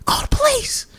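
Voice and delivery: very high-pitched imitated panic; high, comic wail for help; mock frantic plea. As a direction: imitate a desperate man crying for help. Retells naked man's frantic plea.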